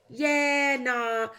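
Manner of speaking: high, loud, drawn-out turn-down; mock polite rejection. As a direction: imitate someone letting you down. Retelling producers turning idea down.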